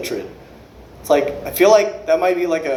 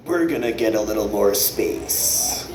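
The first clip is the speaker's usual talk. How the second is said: lower, softer, slow prim voice; 8 semitones lower, 5 dB quieter, slower than usual. Retold or imitated. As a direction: do the stuck-up man, slow and soft. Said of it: "he goes".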